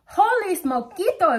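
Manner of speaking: high, loud storybook cry; astonished little hero's outburst; excited exclamation. A narrator's reading. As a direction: exclaim it with pitch jumping up. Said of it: "exclaimed Skippy John Jones".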